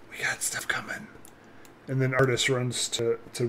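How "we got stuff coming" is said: hushed urgent whisper. Acted. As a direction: breathe it out as a warning. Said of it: "kind of whisper".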